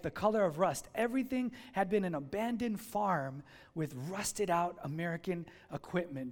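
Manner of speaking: usual speaking voice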